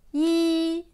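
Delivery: "yi" held high and level; first tone. Shown as a demonstration. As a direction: hold "yi" on one high, even note, loud. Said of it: "Premier ton".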